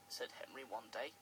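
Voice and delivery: ordinary delivery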